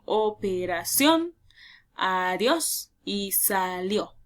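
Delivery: slow, drawn-out syllables with gaps; teacher sounding out syllables for learners. Shown as a demonstration. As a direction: break each word into its syllables, slowly. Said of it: "si yo separo en sílabas".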